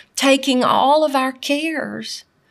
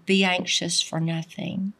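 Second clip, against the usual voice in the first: quiet command; 5 semitones lower, 7 dB quieter than usual. Retold or imitated. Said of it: "It was more of a command".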